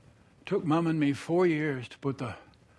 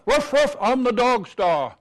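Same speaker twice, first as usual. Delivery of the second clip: playful dog bark; 7 semitones higher, 6 dB louder than usual. Acted. Jack in foil costume barking.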